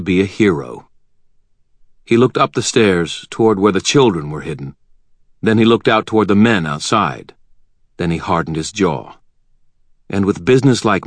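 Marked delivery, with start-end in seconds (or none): none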